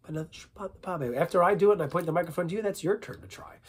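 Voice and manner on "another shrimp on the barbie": quick, softer Australian accent. Shown as a demonstration. Repeating the accent warm-up, quick Australian accent. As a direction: toss it off in Aussie. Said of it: "let's do accent warm-ups".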